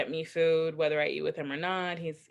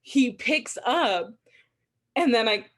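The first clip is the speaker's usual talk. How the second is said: ordinary talk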